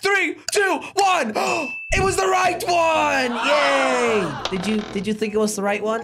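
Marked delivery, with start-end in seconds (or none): 0.0-1.3 excited countdown. Real, not acted. Countdown reveal, rising excitement. 1.4-1.9 excited gasp. Real, not acted. Door opens on reveal. 1.9-3.4 high, slow excited announcement; excited shout. Real, not acted. Reveal shouted. 3.5-4.3 cheering. Real, not acted. Celebrating the reveal.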